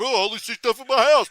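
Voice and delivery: loud, high sing-out of mockery; mocking imitation. [name] mocking Gavin's analogy, exaggerated and loud. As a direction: play up a ridiculous oblivious self. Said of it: "getting out and going".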